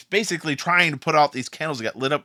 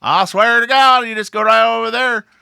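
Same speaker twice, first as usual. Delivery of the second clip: loud, high Southern twang; southern accent; 7 semitones higher, 7 dB louder than usual. Acted. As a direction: holler directions in a broad Southern accent. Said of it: "speak in a southern accent".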